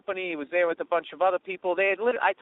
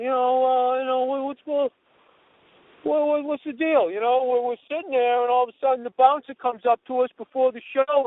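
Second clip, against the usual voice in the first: higher-pitched, stammering complaint; put-on hurt protest, raised in pitch; upset fan; 5 semitones higher than usual. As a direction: imitate an aggrieved fan demanding an explanation. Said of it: "he's all upset".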